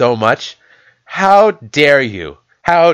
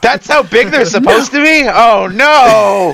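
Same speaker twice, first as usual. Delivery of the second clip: mock horrified; 9 semitones higher, 4 dB louder than usual. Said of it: "I was".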